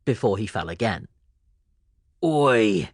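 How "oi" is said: louder gruff "Oi!"; blurted Oi. A narrator's reading. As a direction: bark it at the kid. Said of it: "a man blurted out".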